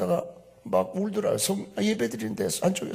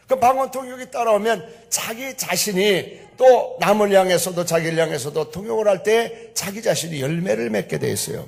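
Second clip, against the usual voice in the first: ordinary talk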